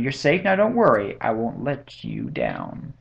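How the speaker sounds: plain reading voice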